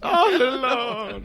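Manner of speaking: very slow, high mock wail; hammed-up drunk impression. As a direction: drag out the drunk's lament through laughter. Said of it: "like, the drunk".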